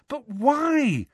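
high, pleading desperation; Harry begging to understand, voice pitched high; desperate. A narrator's reading. Harry pressing the adults for answers, desperate. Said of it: "said Harry desperately".

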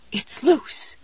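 high, hoarse cry of disbelief; hoarse trembling cry. A narrator's reading. Jessie finding the cap loose, hoarse and trembling. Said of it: "cried in a hoarse, trembling voice".

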